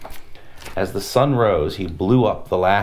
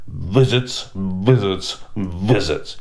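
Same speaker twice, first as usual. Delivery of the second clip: slow grumble, each visits falling; a mildly grumpy emperor fed up with visits; grumbling emperor; much slower than usual. Acted. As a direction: grumble it like a bored king. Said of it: "he grumbled".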